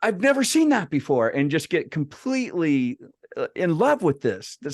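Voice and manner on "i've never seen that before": amazed botanist. Retold or imitated. Botanist discovering a rare orchid, imitated with amazed excitement. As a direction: gush like an expert spotting a treasure. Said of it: "a trained botanist would, like, go".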